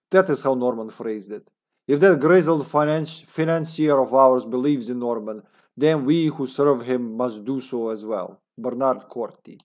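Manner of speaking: put-on comrade Russian accent. Agent's journal about a final plan, Russian accent. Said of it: "a comrade accent".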